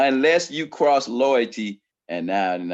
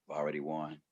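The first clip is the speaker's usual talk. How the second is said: low, soft, smug; smug calm reply; 10 semitones lower, 15 dB quieter than usual. Retold or imitated. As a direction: quietly claim victory.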